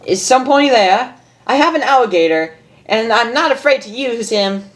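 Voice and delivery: Pinkie Pie voice. Acted. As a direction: play a bubbly pony bluffing bravely. Pinkie voiced, threatening intruder.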